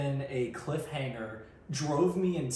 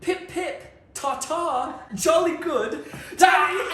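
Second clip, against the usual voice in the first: high, hammed-up upper-class British toff; mock British accent; 14 semitones higher, 8 dB louder, slower than usual. Dad greets the kids in hammed-up British, laughing. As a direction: play the dad's cheesy British greeting, laughing through it. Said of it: "your dad exclaims".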